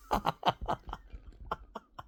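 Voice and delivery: short burst of chuckles; laughter. Real, not acted. Laughs while retelling cousin's reply.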